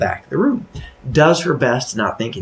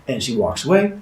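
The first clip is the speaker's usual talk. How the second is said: ordinary delivery, nothing marked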